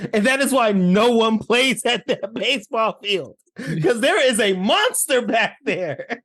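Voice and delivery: high, hammed-up legend telling; mock solemn warning. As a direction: declare it emphatically, as a playful dire warning. Acting out the kids' monster warning.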